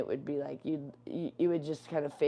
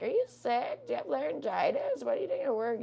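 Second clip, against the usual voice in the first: nosy caller impersonation; 8 semitones higher than usual. Retold or imitated. Callers asking why she is at work, imitated nosy questioning. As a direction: imitate pestering callers in a high put-on voice.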